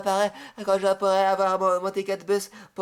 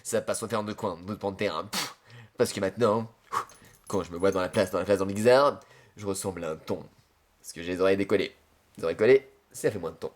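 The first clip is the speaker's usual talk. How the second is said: much lower, softer put-on voice; deep low Adrien voice; 11 semitones lower, 4 dB quieter than usual. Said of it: "dis-le, Adrien, dis-le".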